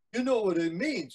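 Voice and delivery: storyteller voicing a worried boy; alarmed boy. Boy warns of coming wave.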